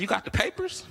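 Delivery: high skeptical ask, rising at the end; skeptical question. Retold or imitated. Comic asking the seller for papers, skeptical. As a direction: poke at him with doubt.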